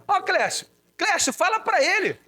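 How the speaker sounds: high, fussing mother voice; mother pleading. Retold or imitated. Mother begs Clécio to talk to brother, high and fussing. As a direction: pitch up as the exasperated mum.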